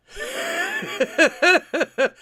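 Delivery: long, high-pitched burst of laughter; high helpless laugh. Real, not acted. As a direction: crack up loudly. Cracking up at a clip.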